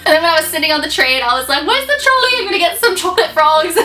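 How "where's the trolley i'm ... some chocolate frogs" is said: high, bright mock British accent; a cheeky Harry Potter fan in a British accent; light British-accent impression; playful British accent. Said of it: "I love talking in a British accent".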